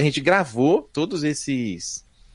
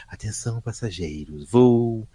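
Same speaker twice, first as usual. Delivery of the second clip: imitating sexy airport announcer; 6 semitones lower, slower than usual. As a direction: purr it like a sultry airport announcer. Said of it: "Uma voz toda sexy".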